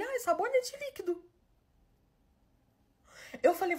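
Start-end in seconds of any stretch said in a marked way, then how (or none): 0.0-1.2 put-on much higher, light and casual boyfriend; boyfriend's voice. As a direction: toss it off in a high innocent voice. Voicing the boyfriend's cheerful answer.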